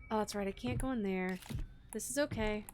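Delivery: normal talking voice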